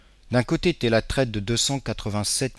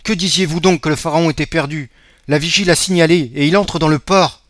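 excited news; 6 semitones higher, 8 dB louder than usual. Acted. Son excitedly reports the ship.

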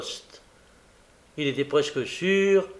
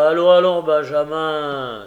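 impatient cry; 2 semitones higher, 8 dB louder than usual. A narrator's reading. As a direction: hurry him along impatiently. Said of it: "s'écria le postier avec impatience".

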